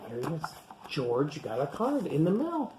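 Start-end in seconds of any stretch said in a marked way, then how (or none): none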